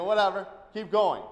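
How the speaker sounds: loud brush-off; dismissive shout. Retold or imitated. After the man gestures rudely, loud dismissive shout. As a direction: wave him away loudly, done with him. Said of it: "Who are you yelling at?".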